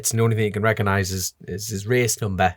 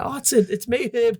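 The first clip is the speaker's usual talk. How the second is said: pleading son; 12 semitones higher than usual. Retold or imitated. Son imitates himself reassuring dad.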